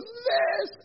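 very high mock-tearful squeal; imitated crying wife. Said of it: "wife's sitting on the staircase crying".

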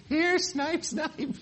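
high coaxing call into the dark woods; calling the snipe. Pretend. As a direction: lure the pretend bird, teasing.

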